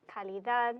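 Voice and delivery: calidad with the stress on DAD; last-syllable stress. Shown as a demonstration. Teacher showing the consonant-ending pattern, calidad stressed on DAD. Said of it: "emphasis is going to go on the last syllable".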